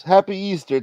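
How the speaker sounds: ordinary talk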